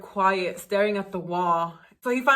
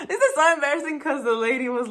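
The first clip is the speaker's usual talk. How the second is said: embarrassed laughing; 6 semitones higher, faster than usual. Real, not acted. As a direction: giggle it out, half-shouting, flustered. Laughing at her own embarrassing story.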